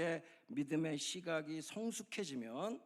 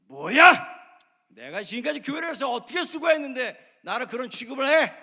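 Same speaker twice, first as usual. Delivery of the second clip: much higher, loud furious shout; angry outburst; 9 semitones higher, 18 dB louder than usual. Acted. Churchgoer exploding at home over unrecognized service, angry shout. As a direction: explode with indignation, loud and high. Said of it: "화가 터져나와요".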